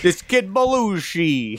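a hint of an Italian accent, drawn out. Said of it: "that was a little Italian".

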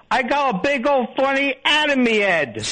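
high, hammed-up British comic accent; British lowbrow comic accent. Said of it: "and the guy goes".

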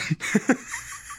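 airy wheezing laugh; breathless silent laugh. Real, not acted. Storyteller wheezing with laughter mid-story.

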